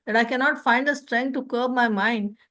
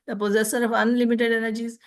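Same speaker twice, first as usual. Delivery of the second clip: usual speaking voice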